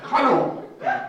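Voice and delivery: a loud, bright hallo into the recorder; loud, upbeat hallo; cheery greeting. Shown as a demonstration. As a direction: hallo said like a greeting sent into space. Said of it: "Ich sag einfach".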